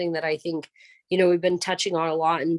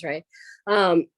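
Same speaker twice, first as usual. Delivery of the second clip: ordinary talk, nothing marked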